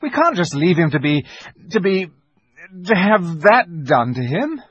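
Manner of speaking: put-on high, urgent boy grasping at plans; impassioned protest, pitched well up; agitated pleading. A narrator's reading. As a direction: argue desperately, stumbling for words. Peter argues desperately for a rescue.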